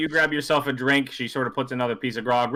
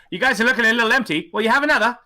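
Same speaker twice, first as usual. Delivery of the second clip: high, fast, louder barmaid; barmaid offering refills; 9 semitones higher, 5 dB louder, faster than usual. Acted. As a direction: push another round on them. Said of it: "She goes".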